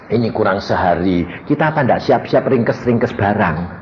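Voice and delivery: ordinary delivery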